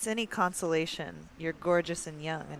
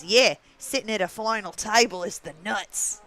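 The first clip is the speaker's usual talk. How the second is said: put-on lightly done Australian accent, a bit louder; Australian accent, mock rating bait; 2 semitones higher, 6 dB louder than usual. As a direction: try an Aussie voice for a laugh. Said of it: "Rate my Australian accent".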